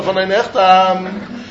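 von ein Echtam drawn out slowly, each word stressed; slow, spelled out. Shown as a demonstration. Teacher spelling out the phrase for students, slow. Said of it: "you have to say".

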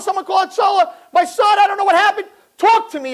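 loud, high urgent calling out; frantic calling for help. Pretend. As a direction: yell for help over the child. Mother calls for help.

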